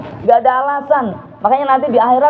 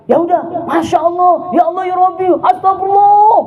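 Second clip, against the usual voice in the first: higher, rising to a shout; angry exclamations; 8 semitones higher than usual. Retold or imitated. Angry believer shouting pious words, rising shout. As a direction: blurt pious words in a temper. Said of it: "Tapi kita marah".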